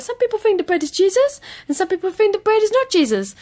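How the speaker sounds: put-on a young boy earnestly explaining religion with bread; young boy Andrew. Comedian doing her boy character Andrew for the interviewer, high childish voice. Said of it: "and Andrew talks like this".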